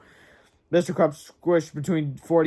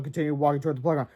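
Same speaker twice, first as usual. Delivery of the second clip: ordinary delivery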